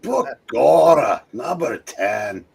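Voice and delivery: hammed-up Irish cop, slow on Begorra; stage Irish cop rating a comic; a light stage-Irish cop voice; Irish cop accent. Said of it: "You have the best Irish cop".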